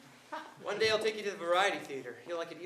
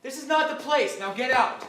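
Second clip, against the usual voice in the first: loud, higher-pitched order; angry dismissal; 5 semitones higher, 8 dB louder than usual. Acted. Man ordering his cast-off lover out, angry dismissal. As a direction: throw out an unwelcome visitor.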